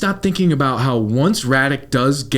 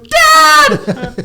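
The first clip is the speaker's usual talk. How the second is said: much higher, louder shrill yell of dad; hammed-up scream for dad; mock scream; 7 dB louder than usual.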